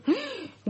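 sharp, high gasp of shock; sudden high gasp, mother hearing her daughter is accused. Acted. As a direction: suck in air as if struck by the news.

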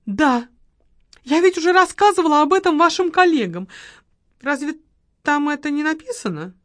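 a touch of irritated lady; peevish widow. Acted. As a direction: brush off his question. Margarita answering the marquis.

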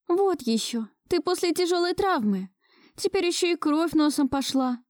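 high-pitched, insistent fretting; dismissive, concerned woman. A narrator's reading. Musya refusing to skip the doctor, insistent. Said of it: "Не стала слушать меня Муся".

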